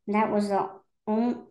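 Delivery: ordinary delivery, nothing marked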